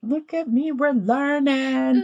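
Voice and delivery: laughing mock pride. Real, not acted. Mock proud reply.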